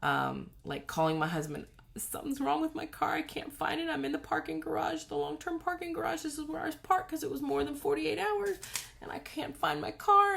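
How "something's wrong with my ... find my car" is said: much higher, strained and close to tears; on the edge of breaking down over a lost car; hysterical panic. Retold or imitated. To her husband on the phone, hysterical and near sobbing. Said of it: "was one hundred percent hysterical".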